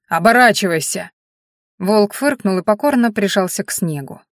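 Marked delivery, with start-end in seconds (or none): 0.1-1.1 громкий грубый окрик; гаркни приказ стражника. Acted. Стражник повторяет приказ громче. (громко и грубо приказывая)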